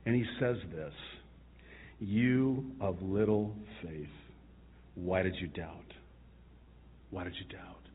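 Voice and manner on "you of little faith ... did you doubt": gentle rebuke. Acted. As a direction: chide him tenderly, fading away. Jesus gently rebukes Peter.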